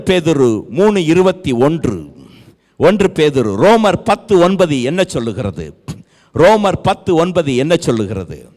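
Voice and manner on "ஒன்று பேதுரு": normal talking voice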